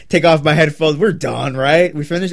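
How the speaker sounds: ordinary talk, nothing marked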